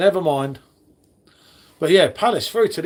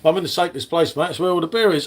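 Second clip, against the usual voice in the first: normal talking voice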